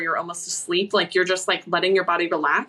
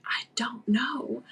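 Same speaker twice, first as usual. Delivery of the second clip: slow, soft whisper; hushed, drawn-out retold answer; bewildered whisper; 2 semitones higher, 7 dB quieter, slower than usual. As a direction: whisper it slowly, completely at a loss.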